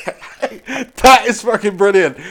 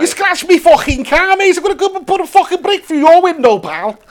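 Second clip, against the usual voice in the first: high-pitched hammed-up Scouse threat; badly faked Scouse aggro; mock Scouse accent; 8 semitones higher, 3 dB louder than usual. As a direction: rant like a caricature Liverpudlian. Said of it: "that accent was proper abysmal".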